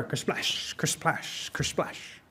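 hushed, unvoiced splash sound effect; imitated splash. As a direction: whisper each splash like a rock hitting water. Imitates rocks splashing into water.

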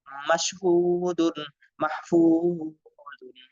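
বুরুজুন and শাকুরুন with each long u stretched slowly; slow, stretch every long vowel for students; drawn-out madd vowels. Shown as a demonstration. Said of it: "এরকম ভাবে সবগুলো পড়বেন". (টেনে টেনে ধীরে উচ্চারণ)

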